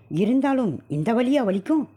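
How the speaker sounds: high, urgent doubt; anxious insistence. Acted. Husband argues with wife about the pain, high anxious. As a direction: refuse to believe it was an insect.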